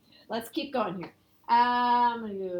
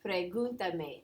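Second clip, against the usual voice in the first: "Pregúntame" stressed on the u of "gun"; 4 semitones lower, 4 dB quieter, slower than usual. Shown as a demonstration. Teacher shows class where the accent falls, stress on GUN in "Pregúntame". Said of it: "On the U".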